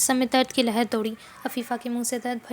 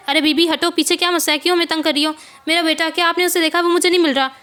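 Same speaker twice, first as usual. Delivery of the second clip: louder, higher, desperate asking; frantic mother's plea; 5 semitones higher, 8 dB louder than usual. Acted. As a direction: beg passers-by for your lost son. Mother begging strangers in market. (घबराई हुई माँ की ऊँची, ज़ोरदार फ़रियाद)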